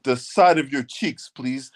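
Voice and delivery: imitating stilted politeness with mocking hesitation; mock polite request. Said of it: "We not saying no shit like that".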